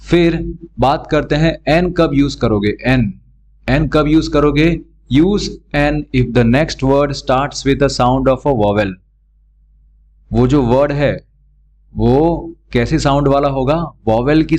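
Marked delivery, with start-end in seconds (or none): 5.1-8.9 reads the rule out very slowly; slow rule reading. Shown as a demonstration. Teacher reading the grammar rule aloud slowly to students. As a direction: dictate the rule word by word.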